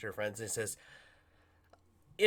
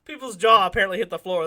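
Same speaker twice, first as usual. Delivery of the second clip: ordinary delivery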